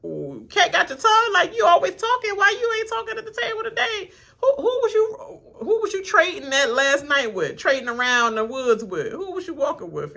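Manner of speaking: high, laughing mock grilling; playful imitation of the table's questions; teasing interrogators. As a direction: needle him in a high teasing voice. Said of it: "they was like".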